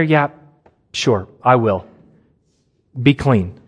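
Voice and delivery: offhand shrug of a reply; casual offhand reply. Shown as a demonstration. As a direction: toss it off lightly. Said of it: "to hear Jesus responding here".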